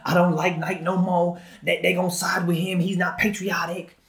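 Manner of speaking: slow, drawling mock hillbilly accent; caricatured Southern hillbilly, drawn-out words. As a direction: put on an indignant American hillbilly accent. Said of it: "That's a good accent".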